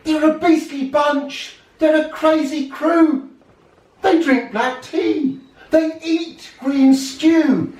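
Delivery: squeaky mock outrage; outraged grandfather. A narrator's reading. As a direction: grandparent forbidding the marriage, high and loud. Grandfather forbids the wedding.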